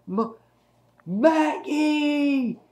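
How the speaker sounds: high, drawn-out mock cry of a name; imitated dying cry. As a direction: strain out a name as acted last words. Dying man's last cry, acted out.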